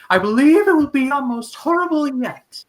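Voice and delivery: sudden big theatrical impression; Jack Skellington voice. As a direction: belt it like a spooky cartoon showman. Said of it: "he will always be Jack Skellington".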